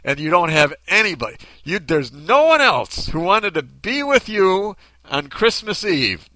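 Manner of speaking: loud, high, mock-astonished questioning; hammed-up disbelief, leaning hard on key words; imagined incredulous usher. Usher imagined grilling a man alone on Christmas Eve, mock incredulous. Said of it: "in my head it's like that".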